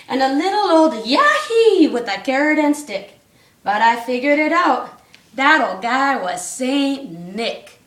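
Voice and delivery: rhythmic read-aloud storytelling; bouncy storybook reading; story narration. A narrator's reading. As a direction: read the rhyme to the class. Reads rhyming Christmas story aloud.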